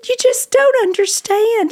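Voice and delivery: high, distraught retold protest; imitated upset wail. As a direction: mimic her pleading through tears. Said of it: "why are you so upset about this".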